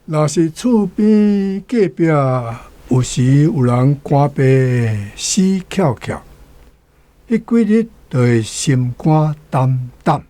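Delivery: each word spelled out slowly for learners; slow, careful classroom reading of the phrase; slow demonstration so students catch 胆胆; slow teaching read. Shown as a demonstration. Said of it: "即个胆胆会晓讲的人愈来愈少".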